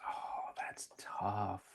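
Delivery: drawn-out breathy whisper; whispered musing. Real, not acted. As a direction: whisper it slowly while thinking. Asked hard question.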